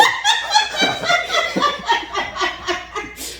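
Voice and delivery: shrieking laugh; helpless high-pitched laughter; high cackling laugh. Real, not acted. Burst of laughter at story.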